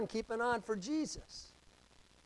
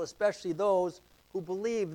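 ordinary talk, nothing marked

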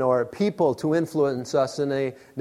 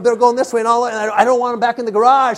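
raised, high, hurried alarm; mock panic; 7 semitones higher, 6 dB louder, faster than usual. Storyteller watching mice head for garage, mock panic. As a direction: gabble it in comic fright. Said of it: "So I'm panicking".

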